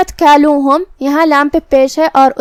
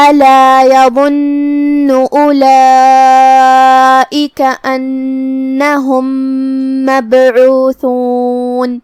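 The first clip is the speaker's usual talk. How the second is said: slow, measured tajweed chant; Quran recitation; 3 dB louder, much slower than usual. Shown as a demonstration. Teacher recites the verse for students, slow tajweed chant. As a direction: recite with each word drawn out. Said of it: "teacher recites the next verse".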